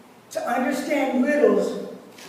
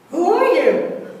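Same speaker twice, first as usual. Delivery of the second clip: high, loud echo from far off; echoing reply; 6 semitones higher, 7 dB louder than usual. A narrator's reading. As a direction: answer back as the mountain's echo. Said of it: "He receives the answer".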